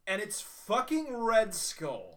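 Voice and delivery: high, loud, drawn-out amazement; thrilled reveal. Real, not acted. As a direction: hammer out the big reveal slowly, pitched high. Thrilled reveal of Red Skull.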